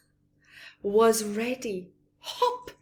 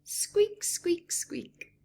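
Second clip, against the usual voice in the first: high squeaky mouse; mouse squeak; 9 semitones higher, slower than usual. A narrator's reading.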